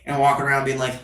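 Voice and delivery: ordinary talk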